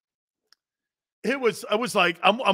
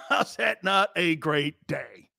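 laughing; 3 semitones lower, slower than usual. Real, not acted. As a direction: laugh through the line. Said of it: "laughing repeat".

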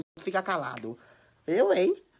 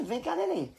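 pet talk; 5 semitones higher, 4 dB quieter than usual. Real, not acted. Woman coos higher to her puppy Jacinto, baby-talk. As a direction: coo it up high like calling a puppy.

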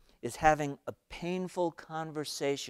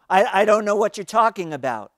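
higher, louder, fast stammering denial; flustered stammer; 8 semitones higher, 11 dB louder, faster than usual. A narrator's reading. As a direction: stumble over the words to avoid him. Father deflects, flustered.

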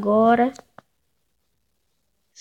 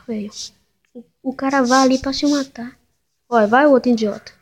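usual speaking voice

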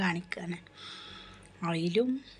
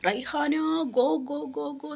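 higher-pitched retold call to a child; imitating mother calling out; 6 semitones higher than usual. (അമ്മ കുട്ടിയെ ഉയർന്ന ശബ്ദത്തിൽ വിളിക്കുന്നതിൻ്റെ അനുകരണം)

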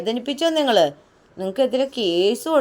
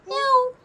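high squeaky kitten meow; mother showing the toddler a cat sound, high meow. Shown as a demonstration. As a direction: mew like a kitten for the baby. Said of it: "പൂച്ചയുടെ sound ഉണ്ടാക്കിയേ".

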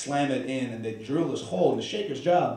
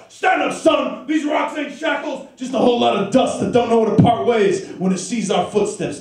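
high, loud rallying cry; ringing preacher's voice; 11 semitones higher, 12 dB louder than usual. Acted. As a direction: ring out like a church bell. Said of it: "his voice would ring out like a church bell".

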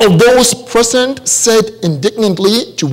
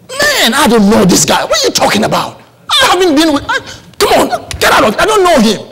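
Peter's denial; 7 semitones higher, faster than usual. Acted. Peter denying Jesus, acted.

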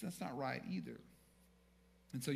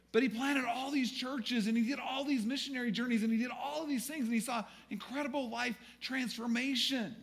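excited preaching; 8 semitones higher, 4 dB louder than usual. Real, not acted. Pastor marvelling to the congregation at Paul's deeds, rising in awe. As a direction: build wonder at every deed.

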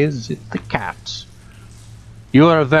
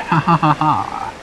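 stiff spelled-out "ha ha ha ha" laugh; a strange deliberate laugh; odd staccato laugh; 3 semitones higher than usual. Real, not acted. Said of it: "the way I just laughed".

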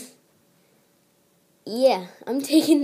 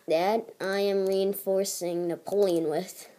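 ordinary talk, nothing marked

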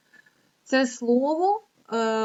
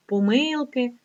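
помилки stressed on МИЛ; wrong middle stress; 2 semitones higher, slower than usual. Shown as a demonstration. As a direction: lean on the middle syllable of помилки. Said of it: "Не можуть бути".

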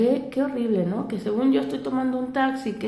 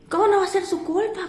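much higher, fast angry retort; mock shouting driver; 10 semitones higher, faster than usual. Taxi driver yelling at the passenger, hammed-up anger. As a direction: fire it back like a yelling driver. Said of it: "así ya gritándome".

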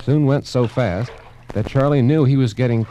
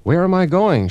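high little shout; tiny shouting voice; 7 semitones higher than usual. Acted. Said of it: "shouted Charlie in a voice as small as he was".

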